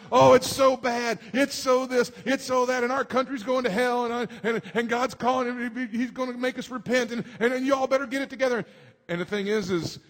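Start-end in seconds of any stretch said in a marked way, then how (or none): 0.1-8.6 higher, louder mock fretting about the country; doom-saying preacher. Pastor mocking alarmist Christians on the news, exaggerated fretting. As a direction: ham up a hand-wringing alarmist. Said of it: "I see all this stuff on the news".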